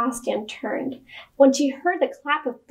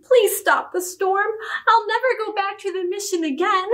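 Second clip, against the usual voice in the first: put-on high-pitched child begging; pleading child; 7 semitones higher than usual. A narrator's reading. Tifam begging Papa to stop the storm, pleading.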